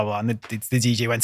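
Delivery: normal talking voice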